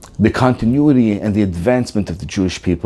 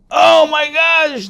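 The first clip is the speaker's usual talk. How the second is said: shocked gasp; 3 dB louder, much slower than usual. Retold or imitated. Crowd reacts in shock.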